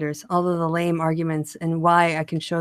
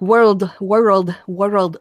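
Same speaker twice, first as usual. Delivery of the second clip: loud warm-up in a fake Scottish accent; shaky grasp of a Scottish accent; attempted Scottish accent; 5 dB louder, much slower than usual. Shown as a demonstration. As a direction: test out a clumsy Scottish burr. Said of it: "do a thick Scottish accent".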